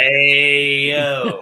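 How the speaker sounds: loud, drawn-out streamer's hey-yo; Nick's greeting. Acted. Impersonating a streamer friend entering a chat, drawn-out greeting. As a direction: stretch out the hey like his catchphrase. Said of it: "if you're doing a Nick".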